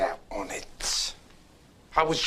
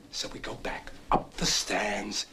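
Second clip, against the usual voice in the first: whispered; 6 semitones lower, 6 dB quieter than usual. Real, not acted. Conspiratorial retelling.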